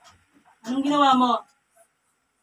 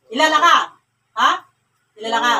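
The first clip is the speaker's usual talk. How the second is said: sharply raised interrogating voice; loud demand; 7 dB louder, faster than usual. Real, not acted. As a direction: fire the question out loud. Interrogation, repeats question loudly. (malakas na pag-uusisa)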